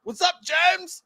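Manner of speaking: high shouted greeting, slowing down; calling out to celebrities walking past; drunk fan call. Retold or imitated. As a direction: holler at them like a tipsy fan. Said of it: "me being a drunk dumbass".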